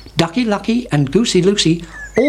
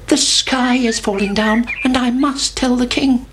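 high anxious chick; 7 semitones higher than usual. A narrator's reading. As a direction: cry out like a worried chick. Chicken Licken alarmed.

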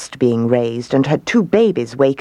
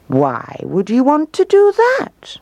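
questioning owl; 11 semitones higher than usual. A narrator's reading. Puzzled owl questions the emu.

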